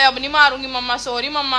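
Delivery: ordinary talk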